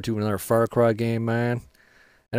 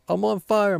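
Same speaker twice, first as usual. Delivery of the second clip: excited brag; 9 semitones higher than usual. Real, not acted. Gloating during a kill streak.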